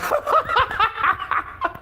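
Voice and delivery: high breathless wheeze; laughing too hard to talk. Real, not acted. Giving up on the clip, high breathless wheeze.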